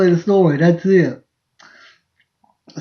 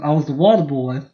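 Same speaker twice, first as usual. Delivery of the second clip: ordinary delivery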